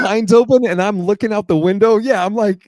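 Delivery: loud, high, wound up; paranoid excitement. Real, not acted. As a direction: tell it freaking out. Paranoid retelling, peering out window.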